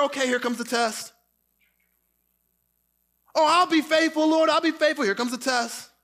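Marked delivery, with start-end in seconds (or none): 3.3-5.0 higher, louder overconfident promise; hammed-up boast of loyalty; eager Peter. Peter boasting to Jesus, mock eager promise. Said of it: "So Peter's like".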